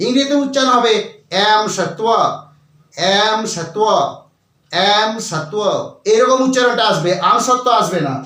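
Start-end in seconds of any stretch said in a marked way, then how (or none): 1.5-5.5 এম সত্ব said slowly, repeated; English pronunciation demo. Shown as a demonstration. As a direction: say এম সত্ব with an English 'em', not আম.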